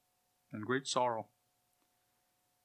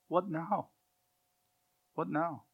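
softly raised, searching question; lost and helpless; 4 semitones higher, 4 dB quieter than usual. Acted. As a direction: ask it as if left without a leader. Voicing the bereft Israelites' thoughts.

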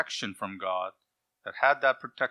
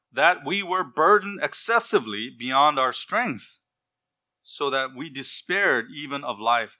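solemn scripture reading; 3 semitones higher, 5 dB louder than usual. Acted. Preacher reads scripture with weight.

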